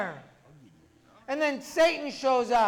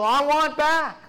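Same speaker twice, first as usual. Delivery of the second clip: higher, louder insistent outburst; eager demand; 5 semitones higher, 6 dB louder, slower than usual. Acted. Man running to his angel to go back, eager demand. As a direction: blurt it out loud and eager.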